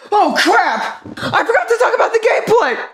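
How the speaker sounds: high sudden panic, laughing; panicked realization. Real, not acted. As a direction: yelp it out in a panic.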